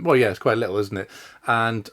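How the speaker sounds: normal talking voice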